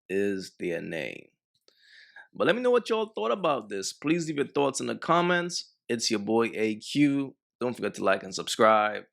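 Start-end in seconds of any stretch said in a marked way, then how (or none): none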